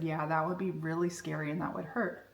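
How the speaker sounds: quiet, tender self-comfort; tender reassurance. Retold or imitated. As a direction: hold her fear gently. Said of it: "just held her fear and said".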